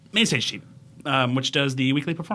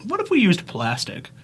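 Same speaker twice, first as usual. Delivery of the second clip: higher-pitched mock eureka moment; imagined Aztec brainwave; 7 semitones higher than usual. Friend imagines ancient Aztecs pondering plastic, higher-pitched playful musing. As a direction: pitch it up like a playful sudden idea. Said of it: "ancient Aztecs were ever like".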